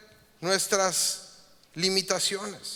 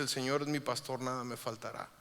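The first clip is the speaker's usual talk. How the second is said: soft psalm reading; 6 semitones lower, 9 dB quieter than usual. Retold or imitated. Quoting Psalm 23 softly.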